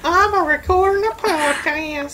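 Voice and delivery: high, hammed-up American yokel; American accent. American Cody answering his own interview question, high mock American accent. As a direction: put on a high-pitched American cowboy voice. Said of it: "interviewing American Cody".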